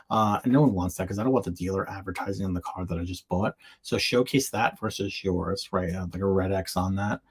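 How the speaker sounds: ordinary delivery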